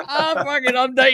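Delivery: high, loud, laughing through it; laughing mock surrender. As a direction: give up while cracking up.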